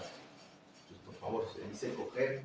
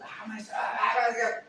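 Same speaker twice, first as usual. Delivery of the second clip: whiny student; 7 semitones higher than usual. Retold or imitated. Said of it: "le da la información".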